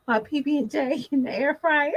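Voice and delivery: laughing through the words; giggling as she answers. Real, not acted. Laughing as she tells viewers his late-night snack.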